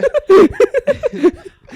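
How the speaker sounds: loud squealing laugh; high burst of laughter. Real, not acted. Laughs hard.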